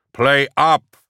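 high, punchy officer's command; urging. A narrator's reading. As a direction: urge him on with a sharp call. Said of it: "urged Gaunt".

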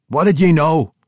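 a loud, high demand. Acted. King Emrys confronting his high priest, demanding. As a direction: press the priest hard. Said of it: "the king demanded".

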